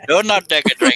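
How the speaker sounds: mock stern warning to a friend; playful warning. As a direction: warn him off teasingly. Warning friend not to drink.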